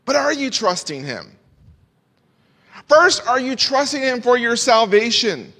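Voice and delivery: high-pitched earnest preaching, landing hard on key words; fervent preaching. Real, not acted. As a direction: press the question on the congregation with conviction. Preacher presses salvation appeal.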